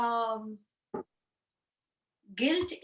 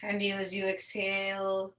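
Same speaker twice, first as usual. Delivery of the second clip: guided meditation. Real, not acted. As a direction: guide them into stillness, drawing out the phrase endings.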